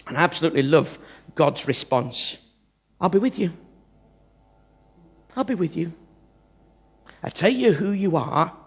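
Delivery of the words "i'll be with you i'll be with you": God reassuring. Acted. God's reply voiced softly.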